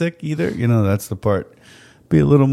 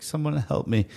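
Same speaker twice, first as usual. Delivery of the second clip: higher, softer helpless plea; mock sick plea; 4 semitones higher, 7 dB quieter than usual. Husband mimicking his sick wife wanting help, pitiful plea. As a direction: imitate a needy sick wife. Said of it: "she's a vocal sick person".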